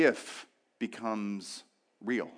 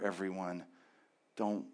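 ordinary talk, nothing marked

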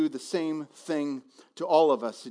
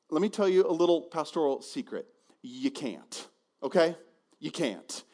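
ordinary talk

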